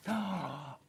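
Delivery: a soft, quick gasp of delight; quiet gasp of wonder; admiring gasp. Real, not acted. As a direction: gasp as if the kit is gorgeous. Admiring the shark kit.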